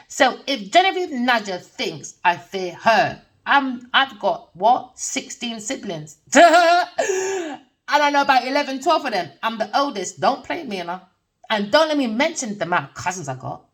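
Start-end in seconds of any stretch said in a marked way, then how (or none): 6.3-7.6 high loud laugh; big incredulous high laugh. Real, not acted. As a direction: laugh it off loudly. Laughs about sixteen siblings.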